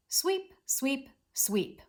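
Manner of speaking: three separated "sweep"s, high then stepping down; sweeping motion. Shown as a demonstration. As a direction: say each "sweep" like a stroke of a broom. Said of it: "in kind of almost a sweeping motion".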